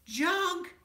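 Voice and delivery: high, loud horrified "Junk?"; shocked cry. A narrator's reading. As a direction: gasp it out in horror. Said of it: "said Brother and Sister Bear".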